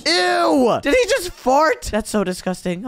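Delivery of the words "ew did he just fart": disgusted. Real, not acted. Disgusted reaction.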